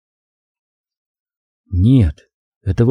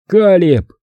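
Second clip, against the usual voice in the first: calling out; 7 semitones higher than usual. A narrator's reading. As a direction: call his name across the street. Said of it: "позвал я". (громко зовёт друга)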